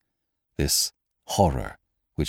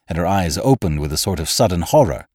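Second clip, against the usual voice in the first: plain reading voice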